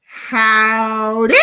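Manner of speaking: loud drawled howdy; hammed-up cowboy greeting; mock Johnny howdy. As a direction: stretch it like a cowhand. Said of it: "the Johnny is like".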